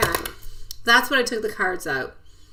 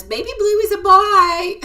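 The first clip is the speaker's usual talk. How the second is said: put-on high, loud parrot baby talk; imitating the parrot's high proud reply; baby bird voice; 12 semitones higher, 8 dB louder than usual. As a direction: squawk it proudly like a tiny parrot. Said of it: "And the Baby Blue says".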